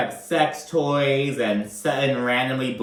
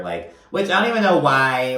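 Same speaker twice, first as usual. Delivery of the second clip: ordinary talk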